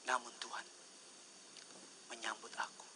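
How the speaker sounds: soft, unvoiced quote of David; whispered scripture. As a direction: breathe the words like a secret.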